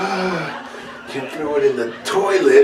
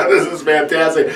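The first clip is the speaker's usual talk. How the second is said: ordinary talk, nothing marked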